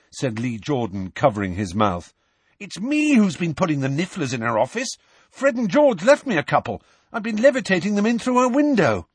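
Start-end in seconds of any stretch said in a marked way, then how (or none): 2.6-9.1 high, dismayed schoolboy; guilty confession among friends; schoolboy confessing. Acted. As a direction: own up in an urgent higher voice.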